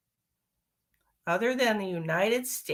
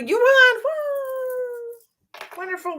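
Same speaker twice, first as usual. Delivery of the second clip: high, loud congratulating whoop; excited cheer; 4 dB louder, much slower than usual. Real, not acted. Streamer to Elspeth, cheering high and loud. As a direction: cheer the winner on.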